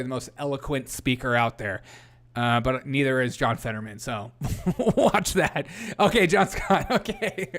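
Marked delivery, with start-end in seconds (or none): 4.6-7.5 talking through real laughter; laughing. Real, not acted. Streamer amused by John Scott, laughing. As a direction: let giggles break up the words. Laughing while answering chat.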